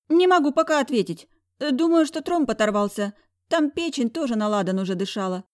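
high, loud nervous doctor's voice; doctor answering the angry boss. A narrator's reading. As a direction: exhale it under pressure. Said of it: "выдохнул он".

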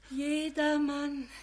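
slow, faint frail call; weak frail voice. Acted. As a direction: call his name weakly from a sickbed. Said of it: "Eine schwache Stimme".

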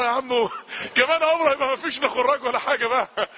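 teasing through laughter; laughing. Retold or imitated. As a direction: laugh while urging him on.

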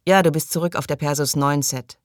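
ordinary delivery

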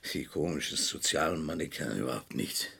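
very low, soft, resentful mutter; defensive, almost voiceless grumbling; agitated muttering. Acted. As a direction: grumble under the breath, trailing into repetition. Cornered man mutters back at questioner.

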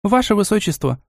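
high-pitched, eager question; mildly wide-eyed curiosity; curious. A narrator's reading. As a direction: ask with keen, polite interest. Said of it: "с любопытством спросила Настя".

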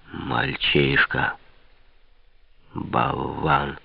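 low, slow, contemptuous scolding; scolding father. A narrator's reading. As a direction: drop low and stretch each insult. Father scolds his son. (низкая презрительная брань)

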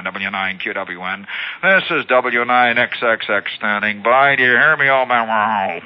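slow, drawn-out radio callsigns; ham operator on air. Acted. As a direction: intone it like a ham operator answering a call. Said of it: "a guy comes back right on the frequency".

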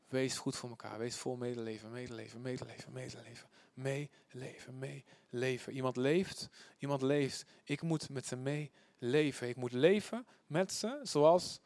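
low, very soft repeating to oneself; murmured meditation. Shown as a demonstration. Reader meditating on a Bible verse, murmured repetition. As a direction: mull the words over under your breath. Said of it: "hardop mompelen".

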